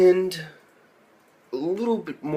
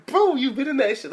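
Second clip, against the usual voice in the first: laughing; 12 semitones higher than usual. Real, not acted. Laughing mock exasperation.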